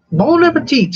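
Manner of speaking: high, loud warbling chef voice; Julia Child impression. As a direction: sing out bon appetit like the TV chef. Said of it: "She always said that".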